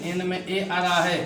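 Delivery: ordinary delivery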